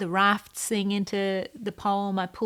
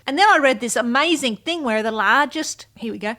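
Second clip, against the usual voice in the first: normal talking voice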